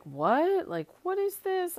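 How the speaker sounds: shooting up high, incredulous what; baffled and a little outraged; mock disbelief. Reacting to virus news.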